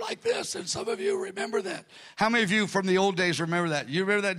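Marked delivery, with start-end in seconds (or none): 0.0-1.8 high, strained, weakened voice; damaged-voice demonstration. Shown as a demonstration. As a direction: imitate straining to talk with damaged vocal cords. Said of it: "I'm talking like".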